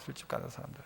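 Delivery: hushed whisper. Acted. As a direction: whisper it like a secret. Whispered repeat. (속삭이듯)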